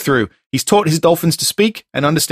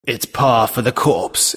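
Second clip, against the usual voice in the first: movie trailer voice; 3 semitones lower, slower than usual. Acted. Repeats tagline trailer style.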